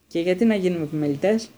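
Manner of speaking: high, loud, appalled question; disgusted. A narrator's reading. Twin answering his mother as if repelled by becoming prefect, disgusted. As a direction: recoil from the very idea. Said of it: "με ένα ύφος, λες και η ιδέα του προκαλούσε αποστροφή".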